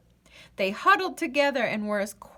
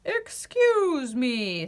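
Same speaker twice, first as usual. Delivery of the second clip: slow, gentle flower voice; friendly daisy; 3 semitones higher, much slower than usual. Acted. Friendly daisy stops the pair, slow and polite. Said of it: "said a friendly daisy".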